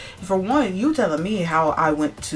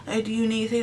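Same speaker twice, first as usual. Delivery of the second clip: gently fussing, mock-sweet voice; imitated sweet concern; 6 semitones higher than usual. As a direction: mimic an overly nice manager checking in. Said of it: "she was being so nice to her".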